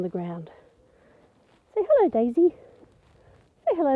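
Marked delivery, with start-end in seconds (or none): 1.7-4.0 high, sing-song coaxing to a pet. Acted. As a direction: coax the chicken sweetly. Coaxing chicken to greet.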